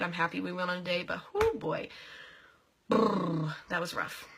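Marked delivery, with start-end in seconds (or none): none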